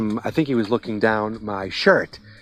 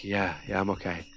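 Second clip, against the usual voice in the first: weak; 3 semitones lower, 11 dB quieter than usual. Retold or imitated. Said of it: "he's like".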